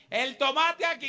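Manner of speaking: high, loud hawker's call; mock market stall cry; market vendor shout. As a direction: shout your tomatoes across the plaza. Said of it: "los gritos así".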